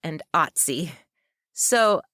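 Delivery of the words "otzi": Otzi, opening on an open "ot" vowel; third pronunciation variant. Shown as a demonstration. Narrator lists ways a nickname is pronounced, third pronunciation variant. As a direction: say "Otzi" as OT-see. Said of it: "pronounced three different ways".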